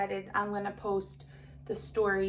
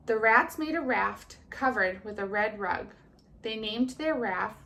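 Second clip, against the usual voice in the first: put-on lively storybook reading to children; kindergarten teacher voice; 4 dB louder than usual. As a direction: read it brightly to little kids. Teacher reads story aloud.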